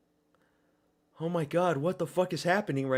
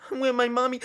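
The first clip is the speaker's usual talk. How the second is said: mock scared baby; 8 semitones higher, 3 dB louder, faster than usual. Mock scared child during fight.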